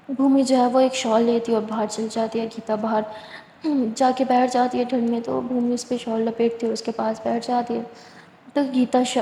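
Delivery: ordinary delivery, nothing marked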